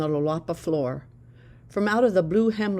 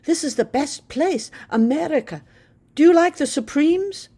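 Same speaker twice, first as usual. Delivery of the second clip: put-on higher, bright eager girl's voice; eager teenage girl; 10 semitones higher, 5 dB louder than usual. A narrator's reading. Girl insists on speaking English, eager and high. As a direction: rush it, eager to practise English. Naledi excited about America.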